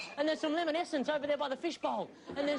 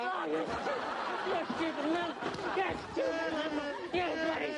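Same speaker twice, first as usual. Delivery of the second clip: stretched slowly through laughter; laughing insult; 3 semitones higher, slower than usual. Acted. Paul laughing at Rich's big head, slowly.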